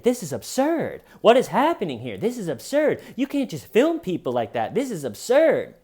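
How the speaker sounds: hammed-up outraged panic; indignant panic. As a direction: shriek in disbelief. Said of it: "he keeps saying over and over".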